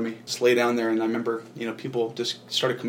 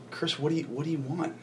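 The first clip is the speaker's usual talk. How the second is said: softly imitated question, pitched up; 4 semitones higher, 8 dB quieter than usual. Man retelling what was asked during prayer, soft questioning. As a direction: ask it quietly, lifting into the question.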